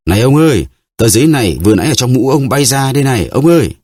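put-on high calling boy's voice running after someone. A narrator's reading. Stable boy runs after the stranger, calling out high. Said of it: "vừa chạy vừa gọi".

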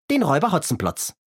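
put-on high, bright boyish puppet voice; cheeky Kasperl. Kasperl answers the constable, cheery and high. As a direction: play the cheerful young rascal, pitched high.

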